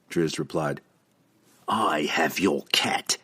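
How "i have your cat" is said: slow, threatening whisper; a whispered taunt to Drizzt about his cat; menacing whisper. A narrator's reading. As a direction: hiss the threat slowly under the breath. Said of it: "Entreri snapped".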